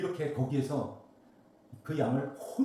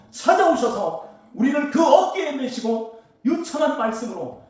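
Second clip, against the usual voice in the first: much higher, louder ringing sermon cry; preaching at full force; 9 semitones higher, 10 dB louder than usual. Acted. Preacher to congregation about storms of the world, fiery preaching. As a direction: lift the whole church with it.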